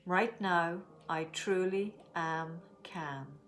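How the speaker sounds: slow calm affirmation. Shown as a demonstration. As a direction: say it slowly, letting calm settle. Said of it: "let's make our statement".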